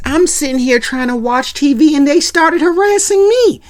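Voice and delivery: high, loud whiny defence; whiny teen. Retold or imitated. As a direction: complain you're the victim. Theo defending himself.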